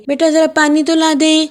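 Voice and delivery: put-on a mother calling her child for water. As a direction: call out like a busy mom asking for water. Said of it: "امی نے آواز دی".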